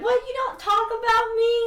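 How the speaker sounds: high, loud, incredulous question; mock offended surprise. Merlin to Cain on not being talked about, high mock offense. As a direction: protest as if wounded.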